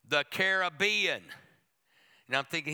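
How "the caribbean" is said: "Caribbean" as care-uh-BEE-un, louder; Caribbean stressed on BE. Retold or imitated. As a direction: say "Caribbean" with the weight on the third syllable. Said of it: "sound near as sexy as Caribbean".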